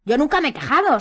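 loud, high indignant retort; heated protest. A narrator's reading. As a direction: jump in hotly to object. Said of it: "saltó Ron acaloradamente".

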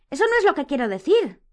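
high, loud, alarmed protest; terrified protest. A narrator's reading. Hermione reassures an angry Harry, terrified. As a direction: hurry to take it back, frightened. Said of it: "contestó Hermione aterrada".

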